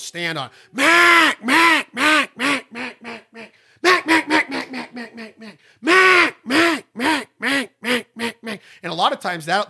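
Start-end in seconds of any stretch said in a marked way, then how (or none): 0.7-4.3 loud high first note held, then the call falls away; high loud hail call that tapers off; standing on the first note. Shown as a demonstration. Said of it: "stand on that first note". 4.3-8.6 loud high first note held, then the call falls away; high loud hail call that tapers off; standing on the first note. Shown as a demonstration. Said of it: "stand on that first note".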